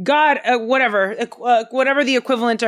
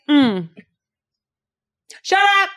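higher, bold "shut up" told to a harasser; tough retort; 10 semitones higher than usual. Retold or imitated. Friend tells the harasser off, high and defiant. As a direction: snap it back, fearless and cocky. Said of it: "and is like".

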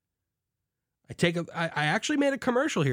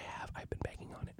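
unvoiced confiding whisper; hushed confession aside; 20 dB quieter, faster than usual. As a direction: whisper it like a guilty secret.

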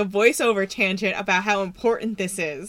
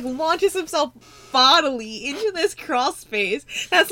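normal talking voice

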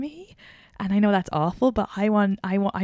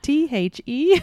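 slow, lightly voiced posh spelling out T H E; mock posh spelling; 7 semitones higher, 4 dB louder, slower than usual. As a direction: spell out T H E like a fussy posh Brit. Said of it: "literally spelling it for you".

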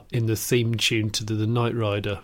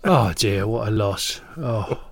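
mock mourning; 4 semitones lower, slower than usual. Cockney sighs over mate's late mum, mock sorrow. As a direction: sink into pretend grief.

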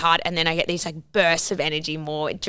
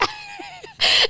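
a squealing burst of laughter, high up. Real, not acted. To friend, laughing at herself, high squeal of laughter. Laughing at her own anger.

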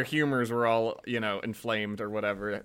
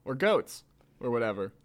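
ordinary delivery, nothing marked

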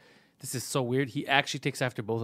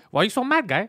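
high, loud, put-on whiny protest; imitated odd talker; 8 semitones higher, 6 dB louder than usual. Co-host mocking how the kid talks, high loud impression. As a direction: mimic his odd way of talking, pitched way up. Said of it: "Why does he talk like that?".

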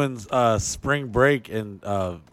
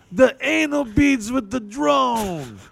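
much higher and slow, savouring each word; drunk club owner; 13 semitones higher, much slower than usual. Retold or imitated. Drunk owner requesting the bit, high and slow. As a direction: drag it out up high. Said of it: "He's like".

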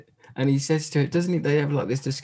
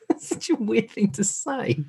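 said through real laughter; laughing. Real, not acted. Laughing at the movie's weird line, giggling through it. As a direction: laugh the words out, higher.

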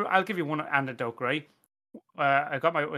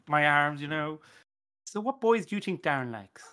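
usual speaking voice

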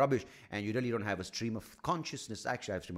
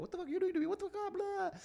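much higher squeaky body voice swearing; body complaining; faster than usual. Pretend. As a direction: play his own irritated body, squeaking. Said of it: "my body's like".

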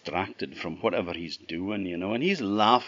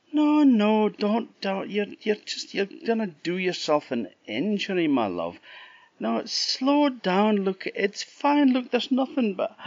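worried Jack; 12 semitones higher, 4 dB louder than usual. Acted. Jack begging the running mule to ease off, anxious. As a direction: coax her gently, anxious.

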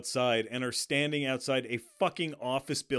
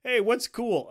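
higher, louder mock question; clueless costume designer; 9 semitones higher, 7 dB louder than usual. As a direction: ask it like an airhead on set.

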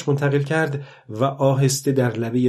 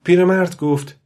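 plain reading voice

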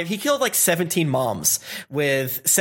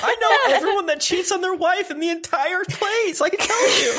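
gossipy voice; 14 semitones higher than usual. Acted. Voicing Eno's reply.

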